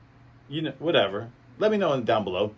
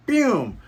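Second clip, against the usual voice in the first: higher, louder "Boom"; impact sound; 9 semitones higher, 5 dB louder than usual. Retold or imitated. Luffy's chop landing on Buggy, loud impact sound. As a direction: punch it out like a hit.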